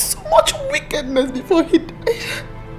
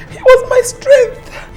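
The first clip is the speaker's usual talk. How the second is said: crying; 11 dB louder, slower than usual. Acted. Grieving mother breaks down.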